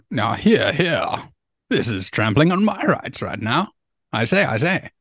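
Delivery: pompous English lord protesting, lightly done; mock posh English. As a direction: play a huffy English lord, a touch high. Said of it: "that good old chop".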